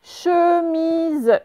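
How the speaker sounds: chemise drawn out high and clear, falling at the end; dictation word. Shown as a demonstration. Teacher dictating chemise to pupils, slow and clear. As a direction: read chemise slowly and clearly for the class. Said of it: "vous écrivez".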